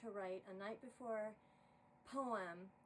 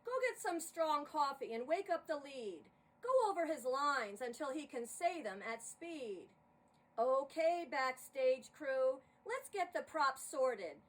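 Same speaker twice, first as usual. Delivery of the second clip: louder, firm but kindly command; Saint Spot calming her; 5 semitones higher, 10 dB louder than usual. Acted. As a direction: take charge and settle the hysterical director. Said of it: "He then said to me".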